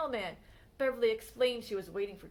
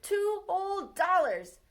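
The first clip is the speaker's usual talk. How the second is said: excited; 7 semitones higher, 4 dB louder, slower than usual. A narrator's reading. Narrator voices her amazement.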